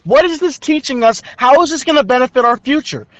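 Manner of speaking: high-pitched, indignant venting; exasperated rant. Real, not acted. Student complaining the skit teaches nothing, exasperated. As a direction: fire off the questions in high-pitched frustration.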